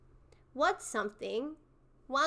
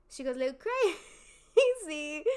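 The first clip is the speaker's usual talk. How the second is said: higher, fast, laughing through the word crazy; laughing tease; 4 semitones higher, 4 dB louder, much faster than usual. Real, not acted. Streamer teasing fans about [name], laughing. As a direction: giggle through it, repeating the joke.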